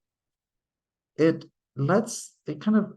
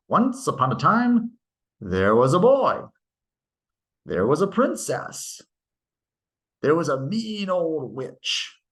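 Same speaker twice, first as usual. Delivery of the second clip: fairy-tale opening, each new character given a lift; storytelling voice; 2 semitones higher than usual. Shown as a demonstration. Teacher showing students a fairy-tale opening, storybook lilt. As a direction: read it like a bedtime story. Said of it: "You see it all the time in stories".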